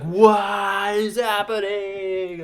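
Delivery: loud, very slow, panicked; freaking out. Retold or imitated. Drunk friend freaking out at intruders, slow scared cry. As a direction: stretch it out like a terrified drunk. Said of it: "He's like".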